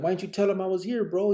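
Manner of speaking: indignant quick question. Retold or imitated. To Chino about the hidden call, fast indignant question. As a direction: fire it off, hurt that he was left out. Upset friend hid the call.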